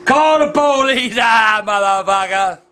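slow, drawn-out furious yell; angry shout. Real, not acted. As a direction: yell it out, stretching the words. Quarrel over thrown camera.

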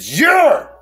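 high, sharp barked word; drill sergeant yell. Retold or imitated. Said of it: "in my drill sergeant voice".

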